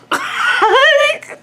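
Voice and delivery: high burst of laughter; loud laugh. Real, not acted. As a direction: let out a big laugh.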